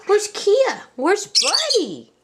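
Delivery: ordinary talk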